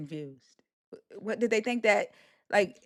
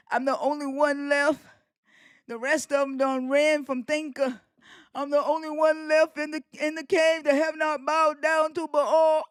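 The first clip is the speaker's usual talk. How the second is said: a hint of a self-pitying prophet, slightly higher; mock prophet lament; 4 semitones higher than usual. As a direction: lightly ham up a lone martyr's complaint. Mocking the troll as prophet Elijah.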